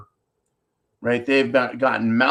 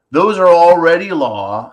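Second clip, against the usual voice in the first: loud insistence; 2 semitones higher, 7 dB louder than usual. Real, not acted. Ranting about his rights.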